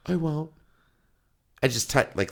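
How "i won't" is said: put-on high, small child's promise; imitated little kid. Little son promising Dad not to tell, meek and high. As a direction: promise meekly like a scared child. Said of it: "And he said".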